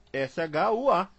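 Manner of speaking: ordinary talk, nothing marked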